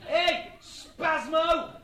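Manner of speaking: loud, high, stretched-out name-calling; jeering shout. Retold or imitated. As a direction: holler a slow taunt across the crowd. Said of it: "shouted Buddha".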